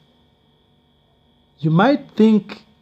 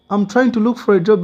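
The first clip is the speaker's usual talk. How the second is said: higher, louder, fast plea; pleading; 8 semitones higher, 7 dB louder, faster than usual. Retold or imitated. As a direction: beg quickly to be heard. Pleading with friend to stay.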